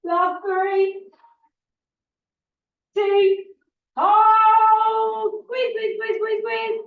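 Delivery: loud, high rep countdown; aerobics instructor. Acted. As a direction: pump up the class to finish. Pushes class through final reps.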